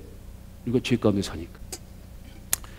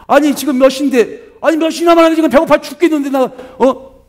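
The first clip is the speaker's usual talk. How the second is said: much higher, louder complaining yell; angry hungry wife; 12 semitones higher, 6 dB louder than usual. Retold or imitated. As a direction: shout that you're starving and he's late. Hungry wife yelling at husband. (배고픈데 늦는 남편에게 소리치는 아내, 화난 고함)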